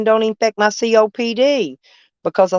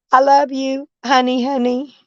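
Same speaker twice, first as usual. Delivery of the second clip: affectionate sweet talk; 6 semitones higher than usual. Real, not acted.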